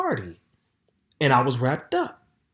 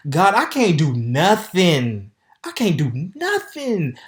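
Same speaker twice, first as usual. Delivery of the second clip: exasperated cry; 5 semitones higher, 7 dB louder, slower than usual. Real, not acted. Despairing at her situation.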